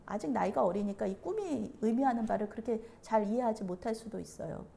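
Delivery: ordinary delivery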